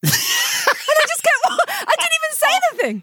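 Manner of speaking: high laughing through the words; cracking up at herself; real embarrassed giggle. Real, not acted.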